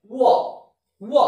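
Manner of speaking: ও said as "wa", like English W; w-sound letter. Shown as a demonstration. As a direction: round the lips into a W glide for ও. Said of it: "এর উচ্চারণটা হবে".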